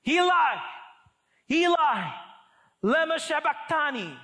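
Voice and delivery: high, loud anguished cry out; voicing Jesus calling out from the cross, loud anguished cry. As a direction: cry it out to the heavens. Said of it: "cried out in a loud voice".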